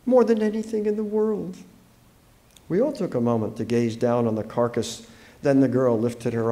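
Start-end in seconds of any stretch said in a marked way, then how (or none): 0.1-1.6 high, grieving voice; choked up. A narrator's reading. Allison telling how she loved her pet, choked up. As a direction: push it out high through a tight throat. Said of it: "She choked up".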